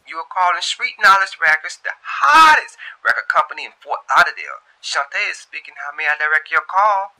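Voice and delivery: high, loud, bright phone greeting; receptionist greeting. Acted. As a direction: put on the professional front-desk voice. Shantae answering office phone.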